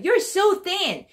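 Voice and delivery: high, slow "You're so thin!"; high surprised exclamation; exclaimed remark. Acted. Acted remark to friend.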